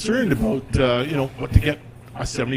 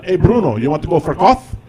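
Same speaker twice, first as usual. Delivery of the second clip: put-on louder Italian-accented invite; dad casually asking his son out; 3 semitones higher, 5 dB louder than usual. Dad asking Bruno for coffee, louder Italian accent. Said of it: "And he goes".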